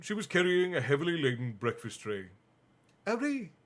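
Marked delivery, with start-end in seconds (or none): 3.1-3.5 put-on much higher, throaty French accent on Harry; a terrible French accent, purred; bad French accent. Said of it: "My French accent is still terrible".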